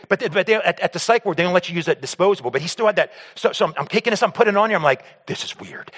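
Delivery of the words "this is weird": breathy whisper, no voice at all; whispering to himself. Retold or imitated. Son shaving his sick dad, whispering to himself. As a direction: whisper the worry under your breath.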